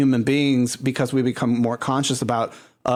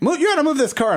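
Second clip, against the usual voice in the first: mock police officer; 13 semitones higher, faster than usual. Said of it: "And the police is like".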